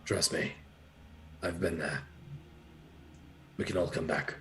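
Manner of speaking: soft, low and consoling; quiet reassurance. Acted. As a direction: coax someone gently through a closed door. Consoling a prisoner through the door.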